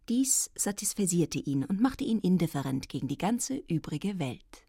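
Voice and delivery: ordinary delivery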